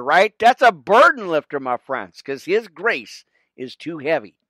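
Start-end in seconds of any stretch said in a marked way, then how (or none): 0.4-1.6 higher and louder, stressing "a burden lifter"; emphatic repeat. Real, not acted. Preacher driving home his line to friends, louder and higher. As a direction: punch "That's a burden lifter" up high the second time.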